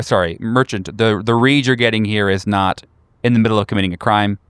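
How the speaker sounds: usual speaking voice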